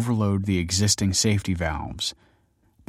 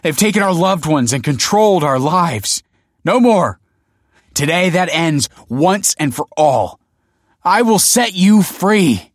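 impassioned high, loud public oratory; crying out; 9 semitones higher, 8 dB louder than usual. A narrator's reading. Rebel promising crowd freedom, loud defiant cry. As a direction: proclaim it to the whole crowd. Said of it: "he cried".

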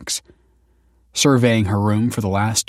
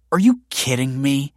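higher-pitched appalled disbelief; disgusted; 5 semitones higher, 4 dB louder than usual. A narrator's reading. Kelsey seeing cat crates for babies, disgusted. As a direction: ask it in open disgust.

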